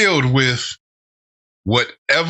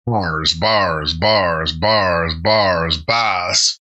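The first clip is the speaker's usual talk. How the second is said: lower, drawn-out Australian twang on bars; mock Australian; 5 semitones lower, much slower than usual. Reacting to a rap verse, imitating the rapper in mock Australian. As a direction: echo the rapper's bars in a jokey Australian accent. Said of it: "he kinda sounded like he was Australian".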